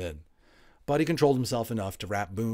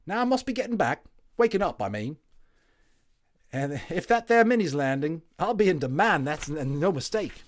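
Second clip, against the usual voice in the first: higher, louder cockney soldier; cheery London Tommy; 8 semitones higher, 7 dB louder than usual. A narrator's reading. Tommy voiced.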